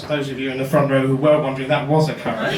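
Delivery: normal talking voice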